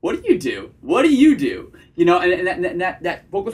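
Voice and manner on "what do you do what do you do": "do" lands firmly, like a teasing statement; flirty hard period. Shown as a demonstration. Coach shows how to ask her job, "do" flirty with a hard period. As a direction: ask "what do you do" flirtily with a decisive ending. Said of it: "that flirty vocal tonality, that sort of, like, hard period".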